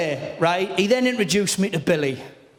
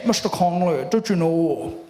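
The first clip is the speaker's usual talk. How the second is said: mock-official dentist, pitched down; Billy Connolly playing someone else; 3 semitones lower than usual. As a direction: lower put-on dentist voice. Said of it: "this is the voice that Billy does when he does somebody else".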